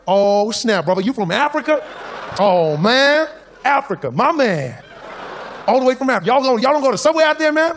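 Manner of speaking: loud, high mock American amazement; amazed American stranger. Train rider astonished to meet an African, loudly amazed imitation. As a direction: exclaim in a hammed-up American street voice.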